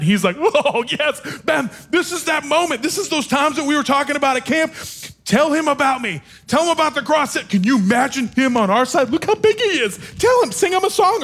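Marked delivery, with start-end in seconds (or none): 0.4-7.4 high, excited cheering-on voice; Jesus, psyched. Retold or imitated. Jesus beside a scared teen urging him to speak up, excitedly. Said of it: "Jesus is psyched. He's excited". 7.4-11.2 very high, giddy excited urging; Jesus, psyched. Retold or imitated. Jesus eager to win over the huge lineman, excitedly. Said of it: "He's excited".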